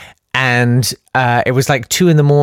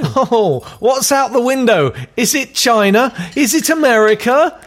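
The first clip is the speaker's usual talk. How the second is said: much higher, peering and wondering; high, wondering spaceman; 9 semitones higher than usual. Acted. Astronaut asks his wife if that is China, high and wondering. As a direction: gaze out the window like it's Earth from orbit. Tim looks out the window.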